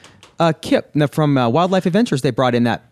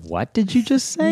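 high-pitched incredulous question; hammed-up astonishment; mock disbelief; 10 semitones higher than usual. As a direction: play a shocked listener, pitch way up. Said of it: "the first person that said".

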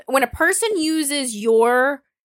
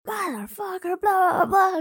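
high mock rant at someone; hint of a screaming fit, pitched up; mock screaming in the face; 10 semitones higher, slower than usual. Mock yelling insults at her partner. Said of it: "screaming in the face".

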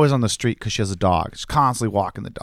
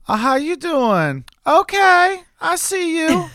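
very high-pitched, drawn-out singsong greeting; impression of neighbor woman; slower than usual. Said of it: "my impression of her is this".

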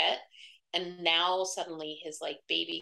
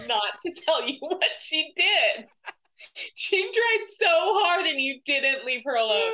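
laughing nearly to tears; 10 semitones higher, 8 dB louder than usual. Retold or imitated. Guy in the corner teasing the room, hysterically amused. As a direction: imitate someone crying with laughter, stretching the words. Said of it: "laughing so hard. He was, like, almost crying".

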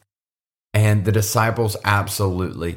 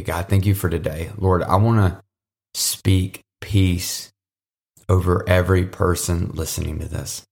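closing prayer; 3 semitones lower than usual. Acted. Closing prayer over the listeners.

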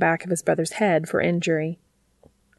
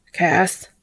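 understated narrated shout; mild shout. A narrator's reading. Dean calling to Cass by the river, mild shout. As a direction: call the name out, held in check. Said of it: "He shouted".

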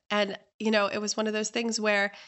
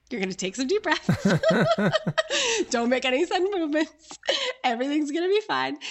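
higher, amused soothing through laughter; laughing mock reassurance; 7 semitones higher, 5 dB louder than usual. Hostess calming a guest in tears, laughing reassurance. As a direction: talk someone down while cracking up.

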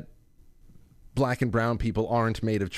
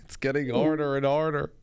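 laughing quote; 4 semitones higher than usual. Retold or imitated. Host quotes caller's child.

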